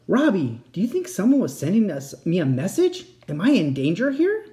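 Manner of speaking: worried. A narrator's reading. Kat frightened asking.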